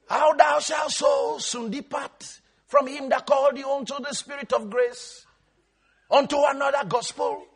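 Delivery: high, level chant as God; intoned scripture. Acted. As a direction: intone it like a liturgical reading. Intones scripture as God's words.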